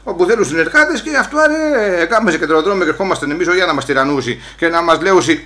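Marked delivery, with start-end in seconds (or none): none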